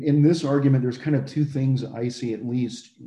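ordinary talk

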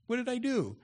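high, whiny what did I do; mock David. Pastor imitating David protesting to his brothers, high and whiny. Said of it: "David was always saying that".

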